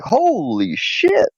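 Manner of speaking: drawn-out, high-pitched mock shock; stunned dog voice. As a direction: stretch it out as a startled dog's reaction. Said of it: "Brownie backed up like".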